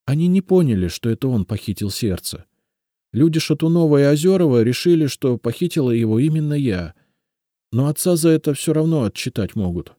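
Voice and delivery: тонкий девичий голос, с надеждой; Елизавета, робкая девушка. A narrator's reading. Elizaveta worried. (голос повыше, робкая просьба девушки)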